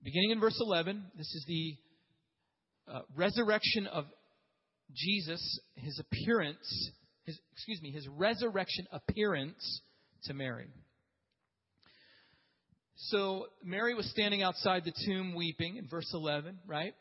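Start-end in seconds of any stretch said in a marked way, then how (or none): none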